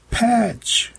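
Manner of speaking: high-pitched, falling exclamation; surprised cry. A narrator's reading. Meg sees her cat at the door, high surprised cry. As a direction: call out the name high, without extra volume.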